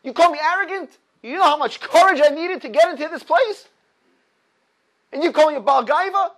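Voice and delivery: high, loud indignant scream; screaming back. Retold or imitated. The frightened man screams back at the corpse, loud and high. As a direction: scream your outrage right back. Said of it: "he screams back".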